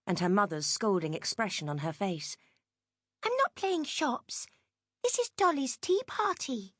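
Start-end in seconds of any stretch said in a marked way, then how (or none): 3.2-6.8 put-on a small girl setting her mother straight; little girl. A narrator's reading. Jenny explains her dolly's tea party, high child's voice.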